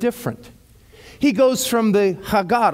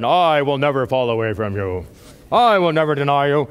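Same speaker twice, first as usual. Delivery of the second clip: much lower, mock-heroic Peter; 6 semitones lower than usual. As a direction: drop the voice way down to sound brave. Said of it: "I'm sure his voice got lowered".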